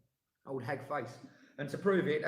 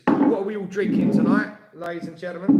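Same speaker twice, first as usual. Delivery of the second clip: host call-out; 3 semitones higher, 10 dB louder than usual. Acted. Host calling to live audience.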